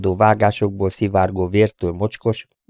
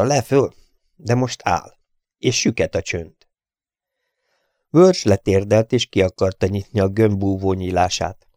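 ordinary delivery, nothing marked

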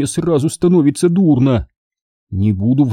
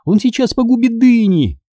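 put-on high-pitched girl's panic; horrified cry; 11 semitones higher than usual. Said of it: "в ужасе вскрикнула она". (в ужасе, высоким голосом)

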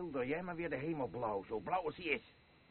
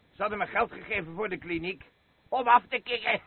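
gleeful boast; 7 semitones higher, 8 dB louder than usual. Acted. Drunk man boasting about the money.

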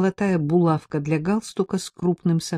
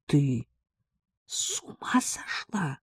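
slow, soft, whispery shock; breath caught, aghast; 6 dB quieter, slower than usual. A narrator's reading. Friend hears of the letters, aghast whisper. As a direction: gasp it out in a stunned whisper. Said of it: "У меня перехватило дыхание".

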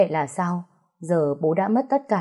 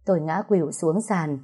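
ordinary delivery

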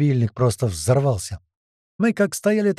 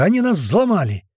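high, raised alarmed cry; shouting; 7 semitones higher, 4 dB louder than usual. A narrator's reading. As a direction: cry out the bad news. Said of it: "закричал Гера". (высокий тревожный крик)

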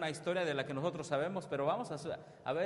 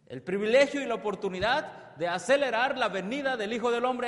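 full-force preaching; 8 semitones higher, 9 dB louder than usual. Acted. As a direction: preach it out to the congregation, stretching each word. Preacher calls out to the congregation. (predicación a toda voz)

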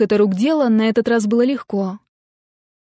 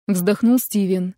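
usual speaking voice

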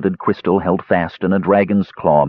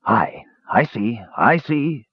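a slow dwarf grumble, half to himself; grumbling dwarf; 3 semitones higher, slower than usual. A narrator's reading. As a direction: grumble it out like a wary old dwarf. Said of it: "grumbled the figure".

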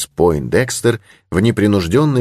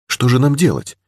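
higher-pitched, worried question; mild fear; 7 semitones higher than usual. A narrator's reading. Young lady turning to her protector, mildly afraid. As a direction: ask it with a first touch of fear. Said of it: "спросила молодая мисс, впервые поддаваясь страху".

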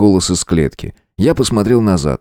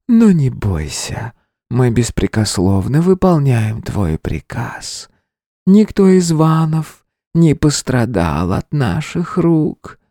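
slow seductive hiss, higher; breathy intimate voice; 6 semitones higher, slower than usual. A narrator's reading. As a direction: purr it slowly like a spider siren. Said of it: "тем же интимным придыханием". (интимное придыхание)

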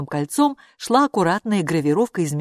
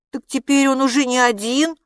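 horrified; 7 semitones higher, 4 dB louder than usual. A narrator's reading. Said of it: "ужаснулся Оскар".

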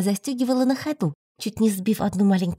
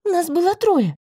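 indignant, higher and louder; 8 semitones higher, 4 dB louder than usual. A narrator's reading. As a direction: protest the unfair blame. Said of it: "возмутилась я".

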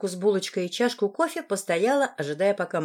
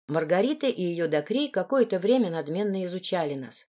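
ordinary delivery, nothing marked

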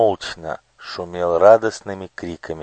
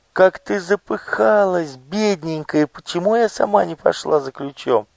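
put-on much higher, caring young girl; tender girl voice; 11 semitones higher than usual. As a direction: fuss over granny lovingly. Olya fusses over grandmother.

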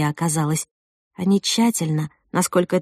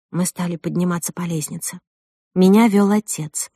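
plain reading voice

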